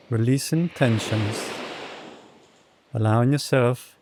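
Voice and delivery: soft, unhurried meditation voice with long pauses; guided meditation. Real, not acted. Yoga teacher settling the class after breath work, soft meditation. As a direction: settle them into stillness, phrase by phrase.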